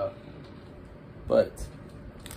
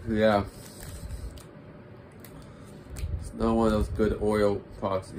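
speaking between bites; talking while chewing. Real, not acted. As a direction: mouth partly full of muffin. Seen 0: Holds dark muffin, repeated bites at 3.1 and 6.6, talks between.

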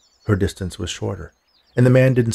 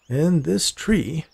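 slow, higher-pitched wonder; awed; 7 semitones higher, much slower than usual. A narrator's reading. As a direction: draw it out in awe. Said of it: "with a tone of awe in his voice".

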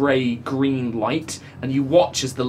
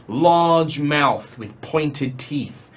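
normal talking voice

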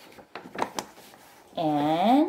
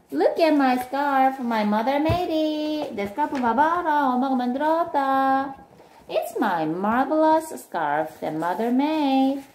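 high, sing-song show-off voice; proud boasting child; 6 semitones higher, much faster than usual. Acted. Milton showing friends the scarf, proudly boasting.

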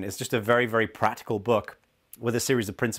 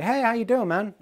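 bright, much higher hello across the street; friendly greeting; 12 semitones higher, 3 dB louder than usual. Retold or imitated. Spotting a friend in the street, an upbeat much higher hello. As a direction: call out a cheerful hey to a friend.

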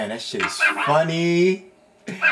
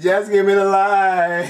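slow, higher patois through a laugh; laughing Jamaican accent; 5 semitones higher, 4 dB louder than usual. Retold or imitated. Player copying the line again, laughing. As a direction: repeat it Jamaican style, chuckling. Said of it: "I can't do the accent right".

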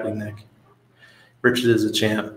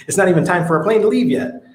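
indignant protest; 8 semitones higher than usual. Retold or imitated. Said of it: "And I'm like".

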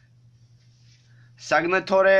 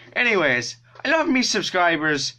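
higher-pitched bad Irish accent; Jacksepticeye; 5 semitones higher, 3 dB louder than usual. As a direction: say it in a put-on Irish lilt. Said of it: "very bad Jacksepticeye Irish accent".

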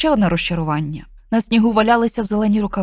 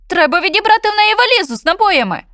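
much higher, louder outraged meow; indignant cat; 11 semitones higher, 5 dB louder than usual. Acted. Cat indignantly demands the witch's suitcase, high and loud. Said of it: "обурено нявчав кіт".

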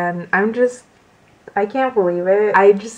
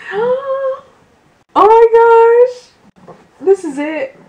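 high, breath-catching exclamation; overwhelmed gasping; 14 semitones higher, 7 dB louder than usual. Real, not acted. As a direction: gasp it out high, overcome by the gift. Gasping over the gift.

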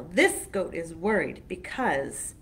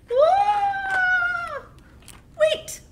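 very high loud squeal of fright; frightened goat; 9 dB louder than usual. Acted. Little goat panicking before the troll, high squeal. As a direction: yelp it in sudden panic.

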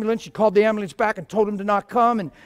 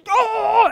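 high, loud seizure groan; imitated loud groan; 13 semitones higher than usual. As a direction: let out a loud strangled "Oh". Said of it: "and it's loud".

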